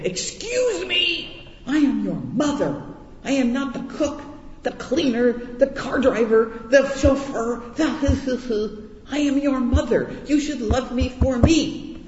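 high, drawn-out indignant mother voice; exasperated mother. Retold or imitated. Mother scolds her kids, high indignant exasperation. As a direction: play the fed-up mother who wants to be loved. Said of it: "grab them by the throat and say".

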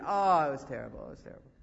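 low mock moan, loud then dropping away; one-upping moaning. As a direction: wallow theatrically in your own suffering. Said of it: "they have to one-up you".

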